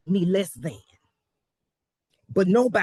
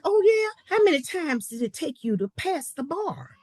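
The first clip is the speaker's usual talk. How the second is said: mock challenge; 7 semitones higher than usual. Opposing man quizzing the new attorney, challenging. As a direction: imitate a doubting challenger, pitched up.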